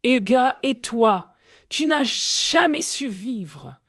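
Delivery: high-pitched angry shout; villain yelling, drawn out at the end; crying out. A narrator's reading. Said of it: "Yo, Victor crie".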